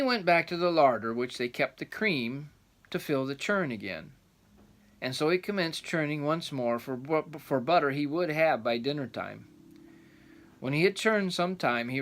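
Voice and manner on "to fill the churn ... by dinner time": ordinary delivery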